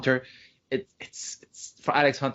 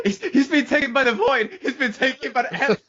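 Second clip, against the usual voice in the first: laughing; 14 semitones higher, 4 dB louder than usual. Real, not acted. Joking Jeff taken by void.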